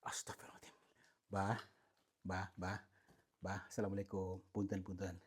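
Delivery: unvoiced whispered astagfirullah; whispered prayer. Real, not acted. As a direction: breathe the prayer out in fear. Startled prayer under the breath. (berbisik istigfar)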